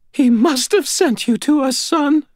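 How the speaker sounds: high, tearful landlady voice; weeping. A narrator's reading. Landlady with hand on rescuer's arm, weeping. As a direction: marvel through tears. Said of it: "weeping openly as she put her hand".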